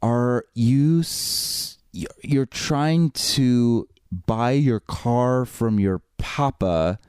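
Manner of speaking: very slow, halting translator voice. Retold or imitated. Man translating for the clerk to a customer, halting and slow. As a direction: feel out each phrase slowly, with long gaps. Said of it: "kinda translates for me".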